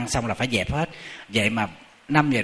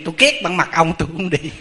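louder, higher mock huff; acted-out blunt grudge, raised voice; blunt refusal; 4 semitones higher, 5 dB louder than usual. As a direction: imitate a woman refusing point-blank. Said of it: "Bà nói thẳng trước mặt thầy".

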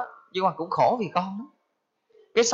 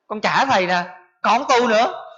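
louder, higher indignant outburst; angry refusal; 5 semitones higher, 3 dB louder than usual. Retold or imitated. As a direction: hand everything back in a huff. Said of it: "Cái giận vô trả thầy trụ trì". (giận dỗi trả lại thầy, nói to và cao giọng)

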